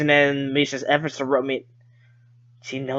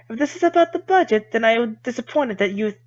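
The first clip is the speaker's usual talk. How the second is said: put-on higher, offended girl voice; politely wounded girl; Lily voice; 7 semitones higher than usual.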